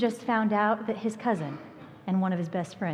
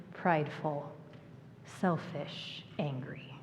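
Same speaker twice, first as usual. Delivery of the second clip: whisper; 5 semitones lower, 10 dB quieter, slower than usual. Real, not acted. Listing traits of hard heart.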